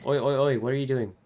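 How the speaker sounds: sudden loud surprised yelp; startled outcry. Acted. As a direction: blurt it out, caught off guard. Startled outburst in story.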